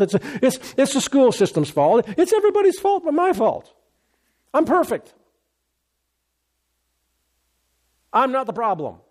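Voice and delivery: high, rising mock whine of a self-righteous complainer; whiny blamer. Preacher mocking people who blame everyone, high whiny voice.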